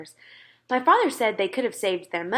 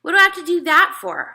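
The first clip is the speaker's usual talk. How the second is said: put-on louder, higher protesting boy; indignant question; 9 semitones higher, 8 dB louder than usual. A narrator's reading. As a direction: push back, annoyed. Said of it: "I asked".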